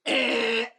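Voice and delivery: high mock electronic beep; device beep. Security guard's gadget beeping against the window, imitated beep. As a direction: mimic the bug detector's squeak. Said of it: "and the thing goes".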